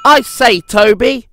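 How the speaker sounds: high, loud indignant outburst; bursting out. A narrator's reading. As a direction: blurt it out in a huff. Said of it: "he burst out".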